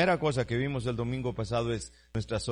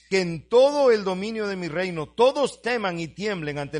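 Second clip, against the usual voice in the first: higher, louder decree; preaching at full force; 6 semitones higher, 9 dB louder than usual. Acted. Preacher reads the king's decree to the church, raised and loud. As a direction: read the king's decree like a proclamation.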